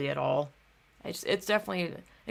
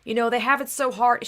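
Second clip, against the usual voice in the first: mocking imitation; 6 semitones higher, 5 dB louder than usual. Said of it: "she kept repeating herself about".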